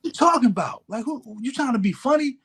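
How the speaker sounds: high, heated mock comeback; bristling at being provoked; defensive. Young self getting defensive.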